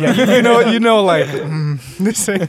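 laughing. Real, not acted. Laughing reaction to bad sushi place.

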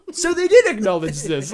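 loud, laughing through the words; laughing realization. Retold or imitated. Reacting to the film acknowledging it, laughing loudly.

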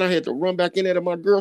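usual speaking voice

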